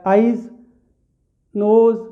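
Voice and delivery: ordinary talk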